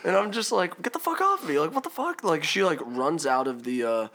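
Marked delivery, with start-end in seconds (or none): 0.8-2.2 alarmed protest. Retold or imitated. Storyteller retelling being grabbed by stepmom, alarmed protest. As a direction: protest in startled disbelief.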